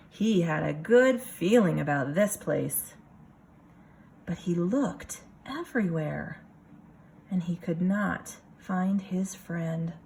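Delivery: lively picture-book reading voice; story narration. A narrator's reading. Teacher reading a picture book to her class, storytelling voice. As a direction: read it for a room of kids.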